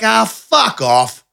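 put-on high, slow drunken dismissal; mock drunken brush-off; imitating drunk self. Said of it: "'Cause I was drunk".

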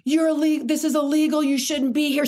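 imitating a rival coach screaming at the player; retold screaming coach.

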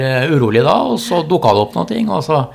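ordinary delivery

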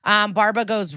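normal talking voice

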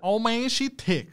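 slow, high mock Mississauga accent; hammed-up Mississauga accent, high and slow. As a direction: drag out a put-on Mississauga "she tick". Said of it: "You don't have the accent down".

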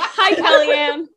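slow greeting through laughter; laughing. Real, not acted. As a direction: laugh while getting the name right. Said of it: "I almost said it".

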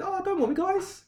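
hammed-up falsetto "don't worry"; squeaky mock Putin. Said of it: "Putin just said".

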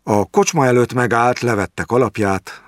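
usual speaking voice

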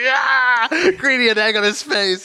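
high, drawn-out whoop; gleeful cheer. Retold or imitated. Retelling colleague's delight at the story, gleeful cheer. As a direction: let out a delighted high yeah. Golic's gleeful reaction, acted out.

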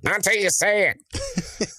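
high, slow sing-song taunt; high taunting Gary. Acted. Gary taunts, higher, slower.

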